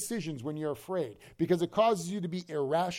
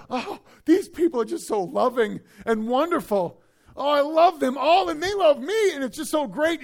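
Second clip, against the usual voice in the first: much higher and louder, starry-eyed gush; gushing new believer; 8 semitones higher, 7 dB louder than usual. Retold or imitated. Preacher voicing a thrilled new believer at church, high and loud. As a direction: gush over the congregation.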